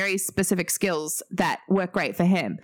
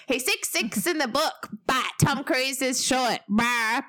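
higher, whiny mock fan; sulky complaining fan, pitched up; 5 semitones higher, slower than usual. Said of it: "but I sounded so Kiwi then".

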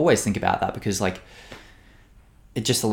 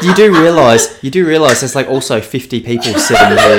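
mock chiding; 3 semitones higher, 8 dB louder than usual. Telling a loud friend others are in the cafe, mock chiding. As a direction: spell it out to an oblivious friend.

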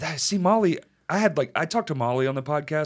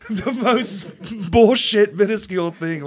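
laughing; 6 semitones higher, 6 dB louder, slower than usual. Real, not acted. Laughing at petty comments.

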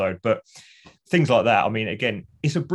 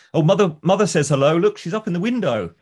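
cheery, higher, louder neighbour; Mister Sweeney; 7 semitones higher, 5 dB louder than usual. Retold or imitated. Neighbour calling to Victor over the fence, cheery and high. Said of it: "he says to Victor".

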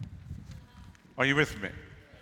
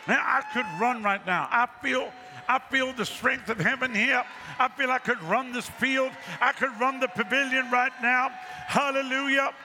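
much higher and rising, fired-up preacher; fervent preaching; 10 semitones higher than usual. Real, not acted. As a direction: lift the room with your faith. Preacher builds up fervour.

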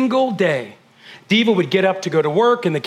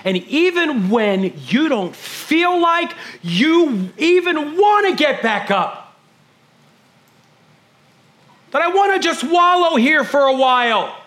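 preaching; 9 semitones higher, slower than usual. Acted. Preaching rises.

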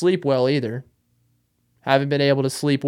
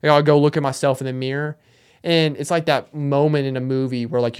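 normal talking voice

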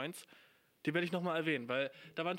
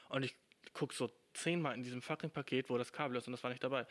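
ordinary talk, nothing marked

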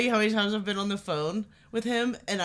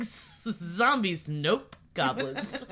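imitating her; 3 semitones lower, 5 dB quieter, much slower than usual. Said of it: "just the way you said it".

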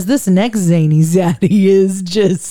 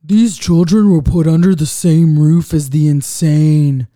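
Zaddy; 2 semitones lower, 5 dB louder than usual. Retold or imitated. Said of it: "And Zaddy says".

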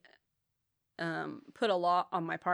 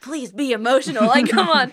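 higher, much louder pleading; mock loud pleading; 6 semitones higher, 14 dB louder than usual. She begs her boyfriend to show emotion, mock loud pleading. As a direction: beg him theatrically.

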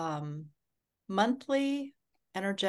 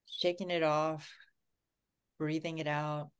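guided meditation. Acted. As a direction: ease everyone into calm and safety. Leads a grounding meditation.

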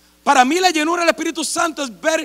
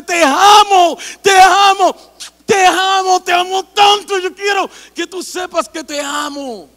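much louder, higher cries of love; God's loving shout; 5 semitones higher, 9 dB louder, slower than usual. Acted. Pastor voicing God to the congregation, loving loud cries. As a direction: shout the love out like God calling his child. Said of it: "me imagino a Dios viniendo y diciéndome".

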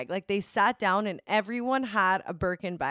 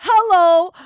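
much higher, loud squealed hello; excited hello; 10 semitones higher, 12 dB louder than usual. Real, not acted. Picturing [name]'s party gifts, she squeals loudly with excitement. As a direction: squeal it like the prize is in reach. Dreaming of Rolexes and Chanels.

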